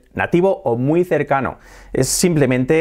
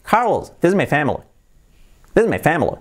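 native English, "family" squeezed to "FAM-lee"; linked, reduced native English accent; 2 semitones higher than usual. Shown as a demonstration. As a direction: imitate a native speaker, "this is" run together. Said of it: "llega un nativo y te dice".

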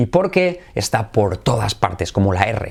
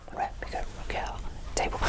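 voiceless whisper, leaving room to repeat; whispered prompt; 16 dB quieter, faster than usual. Shown as a demonstration. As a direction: whisper it along under the learner's turn. Whispers during the learner's turn to repeat. (susurrando para que repitan)

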